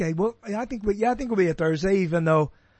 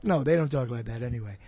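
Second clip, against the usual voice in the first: ordinary talk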